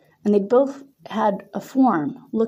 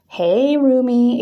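slow, louder sing-song coo; 5 semitones higher, 5 dB louder, much slower than usual. A narrator's reading. Roommate cooing a greeting, slow sweet coo. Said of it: "Adriana cooed".

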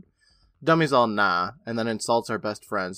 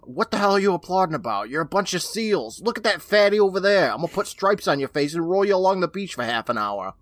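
higher, louder heckling comic; yelling insults; 6 semitones higher, 3 dB louder than usual. Retold or imitated. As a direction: bark insults at the crowd like a roast comic. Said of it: "Don Rickles yells out at them".

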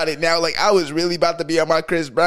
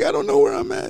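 put-on girl's lost voice; faster than usual. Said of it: "She's like".

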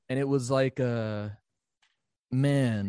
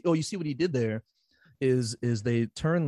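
ordinary delivery

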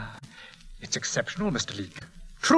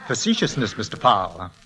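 normal talking voice

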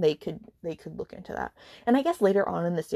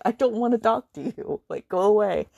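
louder, dismissive push-away; mock brush-off; 3 semitones higher, 5 dB louder than usual. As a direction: shoo him off in jest. Her retold quote.

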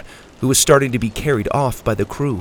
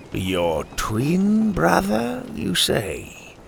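sly pirate captain; 5 semitones higher, 5 dB quieter, much slower than usual. Acted. Pirate captain musing slowly.

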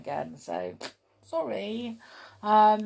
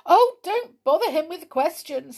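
high, loud worried plea; anxious; 9 semitones higher, 9 dB louder than usual. Acted. Molly anxious not to disturb the gnome, worried plea. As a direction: hush the others, anxious to help. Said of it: "said Molly anxiously".

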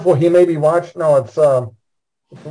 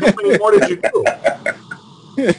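asked through a laugh, slowed down; laughing, slow teasing question; laughing tease; 5 semitones higher, slower than usual. Real, not acted. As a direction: tease him while chuckling. Teasing after director joke.

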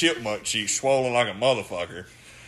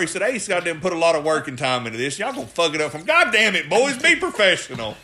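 higher, louder mock rant; hammed-up exasperated scolding; Tank; 4 semitones higher, 3 dB louder than usual. Telling the boys to be professional, mock exasperated rant. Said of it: "And Tank goes".

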